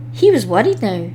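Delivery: ordinary delivery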